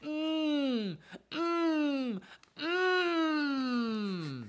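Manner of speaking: three high hums, the last long and falling. Pretend. Co-host introducing the book reading, high drawn-out hums. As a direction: hum high, letting the last one slide down. Hummed cue before the reading.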